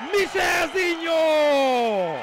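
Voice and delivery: loud, slow goal roar; excited Hungarian sports commentary. Acted. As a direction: bellow the goal, drawing it out.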